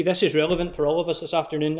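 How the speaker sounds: ordinary talk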